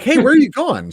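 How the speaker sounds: high, keen asking; eager question. Retold or imitated. Retelling asking his wife, high and eager. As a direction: ask her eagerly, pitch way up. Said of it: "I'm like".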